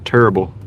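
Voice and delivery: "Terrible" rhymed with gerbil, Southern style. Shown as a demonstration. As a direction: make "Terrible" two syllables, like "turble". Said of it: "you gotta say it like gerbil".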